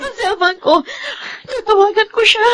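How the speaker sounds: high, tearful cry of panic. Acted. Woman woken by a nightmare tells her sister, crying. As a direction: sob the words out in fear. Panicked after dream of husband.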